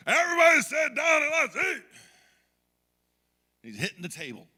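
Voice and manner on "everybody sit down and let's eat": high, full-force bellow at the family; angry dad's yell. Retold or imitated. Dad ordering the family to the Thanksgiving table, angry shout. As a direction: yell it like a fed-up father at dinner. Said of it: "He did that whole moment where he goes".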